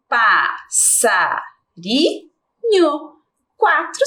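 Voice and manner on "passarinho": passarinho slowly, split into syllables. Shown as a demonstration. Teacher repeats passarinho with the class, syllable by syllable. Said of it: "Vamos de novo?".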